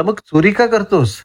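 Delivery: high-pitched sarcastic dig. A narrator's reading. Stallholder taunting the student about stealing, sarcastically. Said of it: "स्टॉलवाला तिरकसपणे म्हणाला".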